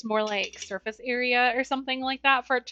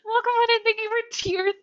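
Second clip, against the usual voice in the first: laughing thanks; 9 semitones higher, 7 dB louder than usual. Real, not acted. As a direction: gush thanks through laughter. Thanking a viewer for a sub.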